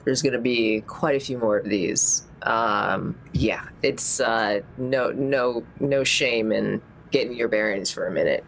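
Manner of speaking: ordinary delivery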